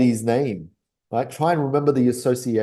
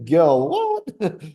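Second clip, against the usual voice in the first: much higher pretend disbelief; baffled; 12 semitones higher than usual. Pretend woman staring at her catch, much higher and baffled. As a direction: squeak out Girl, what? in mock shock. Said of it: "And she's like".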